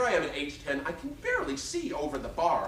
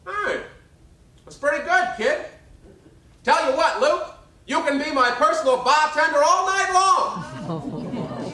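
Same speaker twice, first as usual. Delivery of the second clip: much higher, much louder brassy Broadway belt; 13 semitones higher, 7 dB louder, slower than usual. Retold or imitated. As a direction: belt it to the second balcony. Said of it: "in that booming voice".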